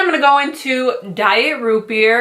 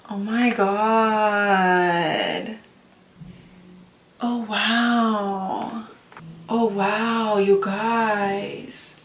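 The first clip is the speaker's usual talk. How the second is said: soft, drawn-out wonder; hushed awe; 6 dB quieter, much slower than usual. Real, not acted. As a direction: breathe it slowly, awestruck. Awestruck on opening the palette.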